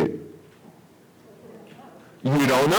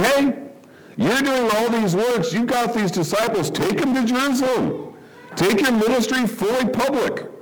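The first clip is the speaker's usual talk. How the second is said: bright, higher, eager push forward; encouraging; 7 semitones higher than usual. Retold or imitated. Brothers urging Jesus to go public, higher and encouraging. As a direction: cheer him on like a supportive sibling. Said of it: "It sounds like they're encouraging him".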